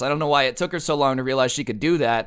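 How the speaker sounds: usual speaking voice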